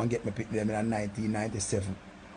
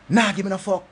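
angry refusal; 6 semitones higher, 5 dB louder than usual. Retold or imitated. Refusing Stacy's request.

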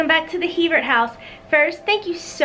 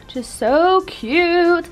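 slow, drawn-out "so cute"; gushing; 6 semitones higher, much slower than usual. Real, not acted. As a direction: stretch it out, charmed. Gushing over typewriter warmer.

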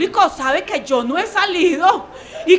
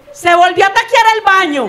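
high, loud public announcement; loud, high, careless broadcast; 4 semitones higher, 5 dB louder than usual. Retold or imitated. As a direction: blurt it out for the whole audience to hear. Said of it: "el señor le dice".

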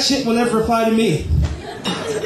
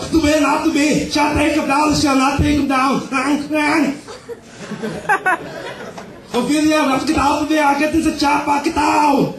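a hint of an action hero; Arnold Schwarzenegger; 7 semitones higher than usual. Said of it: "Arnold Schwarzenegger is Hamlet".

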